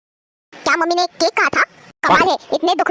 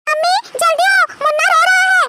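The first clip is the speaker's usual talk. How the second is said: urgent calling out; 10 semitones higher than usual. Acted. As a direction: yell for mom to come quickly. Girl calling mother urgently.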